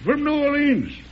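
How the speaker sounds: fuming president naming the source of trouble. Acted. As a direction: spit out the city's name in anger.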